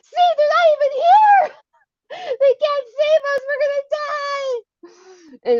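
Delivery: high, loud wailing scream; re-enacted shrieking despair; hysterical panic. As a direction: wail that all is lost, drawing out the end.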